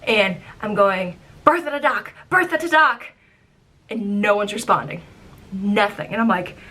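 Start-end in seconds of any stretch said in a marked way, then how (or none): 1.4-3.1 higher, urgent radio call. Retold or imitated. Skipper calling the dock on a dead radio.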